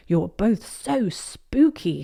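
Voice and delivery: slow, stretched "so spooky"; drawn-out praise. Acted. Granny admiring, words drawn out.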